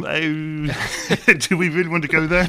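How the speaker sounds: half-laugh a wary question through real laughter; laughing, amused. Real, not acted. Priest laughing at the touchy topic, real laughter.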